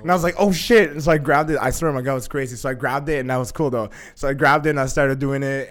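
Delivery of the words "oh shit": higher, louder startled blurt; reenacted shock; alarmed exclamation. Reenacting sudden spinning.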